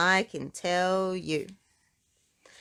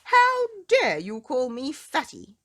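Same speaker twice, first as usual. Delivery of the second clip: higher, louder outraged shout; angry shout; 6 semitones higher, 7 dB louder than usual. Acted. Mister Uppity confronting Mister Small, an outraged shout. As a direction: bellow the accusation in outrage. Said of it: "he shouted".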